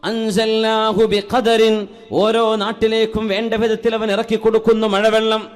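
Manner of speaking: ringing sermon delivery with sustained phrase endings; projected preaching. Real, not acted. Preacher urging believers to thank Allah, projected sermon voice. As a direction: preach it out to a whole congregation.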